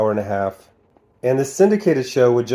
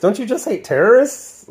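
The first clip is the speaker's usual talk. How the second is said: very high-pitched incredulous question; 11 semitones higher than usual. Real, not acted. Reviewer challenges the soldiers' logic, incredulous.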